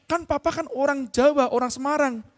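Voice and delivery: high-pitched imitation of a puzzled child; Alvin. Son insisting to papa he is Javanese, imitated child's protest. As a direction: protest lightly in a little kid's voice. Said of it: "terus Alvin bilang".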